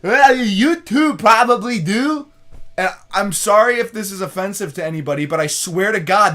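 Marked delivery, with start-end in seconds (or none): 0.0-2.3 high, loud and slow put-on voice; imitating the guy. Retelling what the stranger said to his dad, high and slow. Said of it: "this is exactly how this guy sounded".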